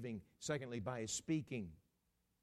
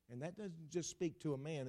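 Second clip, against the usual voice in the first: normal talking voice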